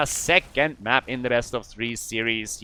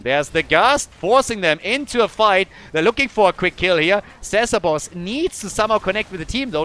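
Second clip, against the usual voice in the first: loud, rising esports call; hyped caster shouting the kills; excited play-by-play; 7 semitones higher, 9 dB louder than usual. Real, not acted. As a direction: call the fight as it happens. Caster calls teamfight live.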